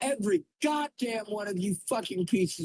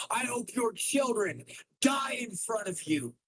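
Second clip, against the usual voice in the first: bitter cry; 6 semitones higher, 4 dB quieter than usual. Real, not acted. Cursing chat.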